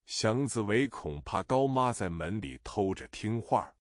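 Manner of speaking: ordinary delivery, nothing marked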